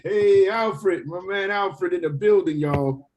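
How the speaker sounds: loud excited shout-out; loud fast street hustling. Real, not acted. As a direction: hype him up loudly. Excited greeting of Alfred.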